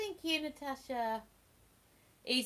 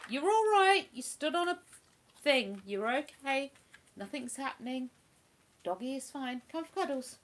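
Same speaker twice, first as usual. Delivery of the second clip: soothing the dog; 4 semitones higher than usual. Real, not acted. Owner comforting her dog after it stepped on something, high gentle coo. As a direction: coo reassurance up high to a pet.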